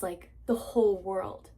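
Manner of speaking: friend's words in a soft whisper; whispered quote. Retold or imitated. Quoting friend Haley's comfort to viewers, whispered softly. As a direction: hush it like a secret comfort.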